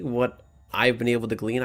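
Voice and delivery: ordinary delivery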